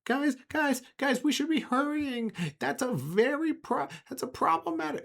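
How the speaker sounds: very high falsetto urging; anxious Mark. Acted. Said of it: "and he goes".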